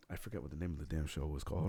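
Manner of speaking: ordinary talk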